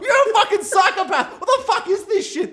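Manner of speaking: high, loud incredulous tirade with laughter creeping in; acted furious outburst; mock outraged yelling. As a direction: yell it like an appalled friend, hammed up. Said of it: "You don't have to get so angry".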